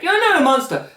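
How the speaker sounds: high-pitched, pleading imitation; mock protesting dad. Cyborg's dad reassuring his son, in high mock protest.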